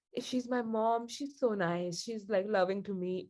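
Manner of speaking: usual speaking voice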